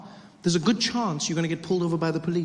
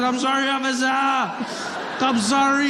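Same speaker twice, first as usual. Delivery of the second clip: higher, drawn-out begging sorry; pleading apology; 6 semitones higher, slower than usual. Retold or imitated. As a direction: plead with the officer, stretch sorry. Begging the officer.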